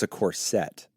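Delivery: "corset" said as cor-SET; second-syllable stress. Real, not acted. Co-player guessing the garment, "corset" stressed on the last syllable. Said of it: "wrong emphasis on the wrong syllable".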